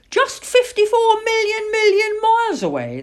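high, loud proclamation; breezy stargazer. Acted. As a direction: toss out the huge figure with relish. Man airily states huge distance.

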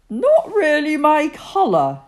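high, loud and sniffy; displeased. A narrator's reading. Ungrateful Bertie rejecting the jumper, a loud, sniffy voice. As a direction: turn your nose up at the jumper. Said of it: "did not seem very pleased".

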